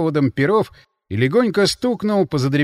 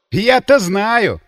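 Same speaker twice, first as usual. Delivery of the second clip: slow, loud, raised gloating retort; mocking guffaw; 7 semitones higher, 7 dB louder, much slower than usual. A narrator's reading. Counterintelligence man mocks the sorcerer's excuses, guffawing. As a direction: draw it out derisively. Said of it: "гоготнул контрразведчик".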